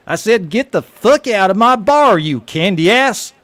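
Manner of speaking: high, loud furious yell; a bartender screaming a customer out of his bar; angry bartender. Acted. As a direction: throw him out of the bar at full volume. Bartender repeats his order angrily.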